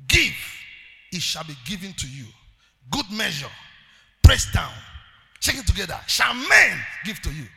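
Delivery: rising to a shout on the quote; preached scripture. Acted. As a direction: build it up and shout the promise. Preacher quoting scripture, building.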